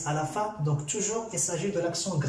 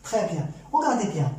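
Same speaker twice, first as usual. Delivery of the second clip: usual speaking voice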